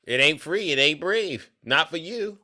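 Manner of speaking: usual speaking voice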